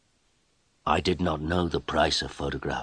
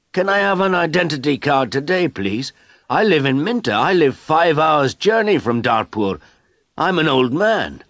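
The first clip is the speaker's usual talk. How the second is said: put-on higher, louder begging old man; pleading old man; 9 semitones higher, 6 dB louder than usual. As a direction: plead with the official, voice lifted high.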